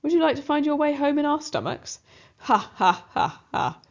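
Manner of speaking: wicked cat laughing; evil cat delight. Acted. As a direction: gloat over the trapped rat. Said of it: "laugh with evil delight".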